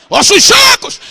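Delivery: loud, high-pitched preaching cry; prophet's shout. Acted. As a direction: call out to the dry bones at full force. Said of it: "começa a gritar".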